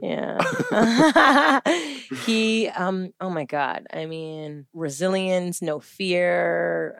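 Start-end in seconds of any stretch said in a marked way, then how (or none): 0.4-2.2 high, long burst of laughter; real delighted laughing; hearty laugh. Real, not acted. Mom amused talking about her son, hearty laugh. Laughing after child's name.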